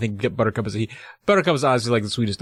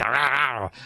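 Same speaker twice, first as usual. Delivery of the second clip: imitated long snarling growl; dog growl; 3 dB quieter than usual. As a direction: growl like a dog baring its teeth. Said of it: "Starts growling".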